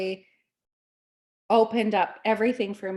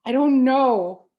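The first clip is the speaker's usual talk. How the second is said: confused; 4 semitones higher, 8 dB louder, slower than usual. Retold or imitated. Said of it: "I was so confused".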